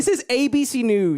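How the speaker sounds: booming announcer sign-off; news anchor voice. Acted. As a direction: read it like a TV station ident. Repeats the news slogan, announcer-style.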